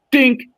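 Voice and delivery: high little "dink" of a car bump; dink of impact. Retold or imitated. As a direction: imitate a light, high metallic knock. Imitating a minor car impact.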